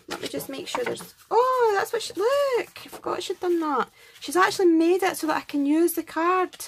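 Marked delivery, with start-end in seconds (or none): 1.3-2.3 high, loud burst of surprise; a real little gasp of discovery; sudden surprise. Real, not acted. Finds hidden detail on card. 2.9-6.5 higher and louder, bubbling with delight; real excitement over a gift. Real, not acted. Delighted by card detail.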